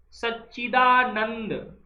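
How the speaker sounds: सच्चिदानंद drawn out slowly, each syllable clear; सच्चिदानंद spoken very slowly, syllable by syllable; slow demo word. Shown as a demonstration. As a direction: say सच्चिदानंद slowly for the class to copy. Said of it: "सच्चिदानंद कैसे लिखते हैं".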